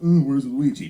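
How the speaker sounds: loud mock taunt from a kid; imitated playground jeer, sudden and loud; teasing kindergartner. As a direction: blurt it out like a teasing child. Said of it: "someone's like".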